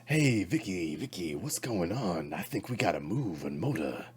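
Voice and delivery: swooping, sing-song hepcat voice; hepcat talk. Acted. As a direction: slide up and down like a cool cat. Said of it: "do you perceive yourself talking like that?".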